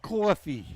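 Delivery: mock Boston coffee. Shown as a demonstration. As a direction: say Coffee. as kor-fee. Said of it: "echo of accent talk".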